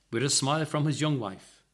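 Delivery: plain reading voice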